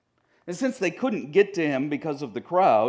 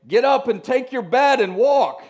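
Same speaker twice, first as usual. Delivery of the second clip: loud, raised-pitch commanding shout; booming healing command; 8 semitones higher, 8 dB louder than usual. Acted. Preacher imagining himself healing a hospital patient, booming command. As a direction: call it out like a bold miracle command.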